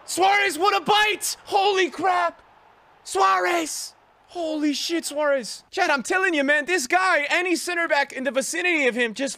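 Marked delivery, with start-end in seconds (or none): 0.0-2.4 high, loud yell of delight; wild gamer yell; excited shout. Real, not acted. Great goal in the game. 3.0-5.6 loud, high thrilled calls of the name; thrilled streamer cheer; excited cheer. Real, not acted. Cheering his striker.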